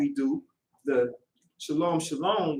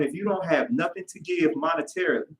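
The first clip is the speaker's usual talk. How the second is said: ordinary delivery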